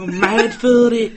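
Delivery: high, slow Manc swagger; Manchester accent. Retold or imitated. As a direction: drawl it like a Madchester raver. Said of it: "Manchester accent".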